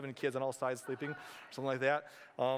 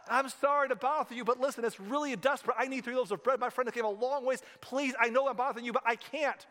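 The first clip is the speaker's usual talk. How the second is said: desperate plea; 8 semitones higher, 4 dB louder than usual. Acted. Desperate friend pleads at door.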